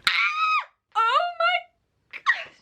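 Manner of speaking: high, loud delighted shriek with a laugh; laughing scream of delight; excited shriek. Real, not acted. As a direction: shriek with joy, slowly. Shrieks at the box.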